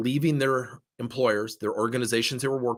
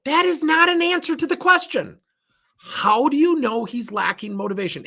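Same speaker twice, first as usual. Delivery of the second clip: emphatic retort; 13 semitones higher, 5 dB louder than usual. Retold or imitated. Coach pushing back on manager.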